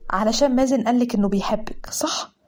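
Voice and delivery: whisper. Acted. Aunt whispering a secret.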